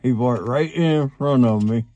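talking around a cigar; cigar in mouth. Real, not acted. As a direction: lips clamped on cigar. Seen 0: Cigar clenched in mouth 0.2–2.8, removed by 3.6.

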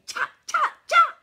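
high, loud shoo-away yell. A narrator's reading. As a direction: shout to chase off the monkeys. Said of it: "he yelled".

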